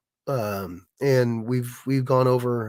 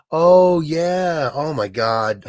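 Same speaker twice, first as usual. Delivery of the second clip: loud, high burst of remembering, then dropping; sudden realization; 4 semitones higher, 6 dB louder, much slower than usual. Real, not acted. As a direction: blurt it as the memory hits. Suddenly remembers the forgotten auction.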